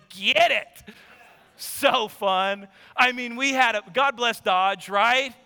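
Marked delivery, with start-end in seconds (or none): none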